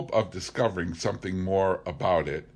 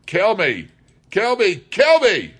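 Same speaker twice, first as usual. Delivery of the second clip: shrieked, much higher and louder, building each time; frantic scream; 14 semitones higher, 12 dB louder than usual. A narrator's reading. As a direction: hurl it up with everything you have. Leach begs to be killed.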